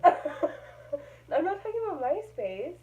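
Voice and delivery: laughing. Real, not acted. Laughing at mom's answer.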